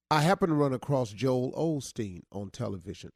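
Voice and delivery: normal talking voice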